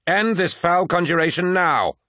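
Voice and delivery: high, forceful commanding shout; shouted order. A narrator's reading. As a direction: shout it as an ultimatum. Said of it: "shouted Valdor".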